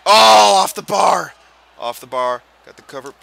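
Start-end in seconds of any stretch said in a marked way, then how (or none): 0.1-1.3 high, loud shout of a near miss; loud outcry. Real, not acted. Gamer reacting to a shot off the bar, loud outcry. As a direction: cry out loudly as the shot misses.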